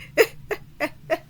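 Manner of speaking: high, breaking laugh at oneself. Real, not acted. Vlogger giggling at a botched word, helpless laugh. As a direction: crack up at your own stumble.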